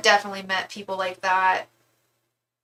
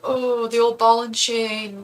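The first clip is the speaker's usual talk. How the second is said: mock bro voice; 2 semitones higher, 3 dB louder, slower than usual.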